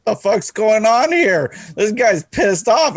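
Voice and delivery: puzzled trainee watching the fight, baffled and loud; bewildered new guy. Retold or imitated. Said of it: "standing over my shoulder just like".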